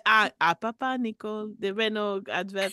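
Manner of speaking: lightly put-on French accent; a hint of a Parisian caller; mild mock French accent. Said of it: "dialing code of my accent".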